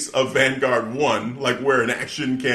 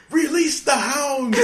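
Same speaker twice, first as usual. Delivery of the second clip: slow, much higher mock shout; villainous command; 14 semitones higher, 4 dB louder, slower than usual. Host imitating Jericho ordering the dogs, hammed-up shout. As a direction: proclaim it like a cartoon villain.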